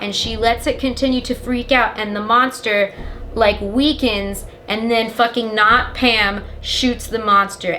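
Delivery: ordinary talk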